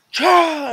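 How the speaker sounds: sudden loud high sneeze burst; loud sneeze imitation. Showing his cohost how he sneezes, loud sneeze burst. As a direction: blast out a sneeze.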